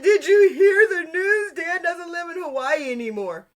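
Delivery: high, hammed-up wail of gossip; mock-dramatic news. Retelling a friend's text about Dan leaving Hawaii, mock distraught. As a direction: blurt it like tragic breaking news, imitating.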